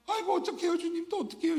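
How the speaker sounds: preacher playing Mary wailing outside the empty tomb; acted wailing. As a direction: wail it out like a mourner. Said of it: "저 연기 잘하나요?".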